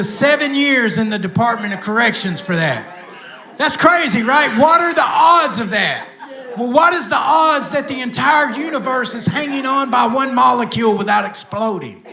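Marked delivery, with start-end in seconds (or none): 3.6-12.0 higher, louder, laughing disbelief; laughing rhetorical shout. Real, not acted. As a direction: throw the question out laughing.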